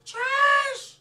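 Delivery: very high, very loud stretched yell; piercing, drawn-out holler; heckling scream. Real, not acted. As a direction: scream it as the last word. One last heckle.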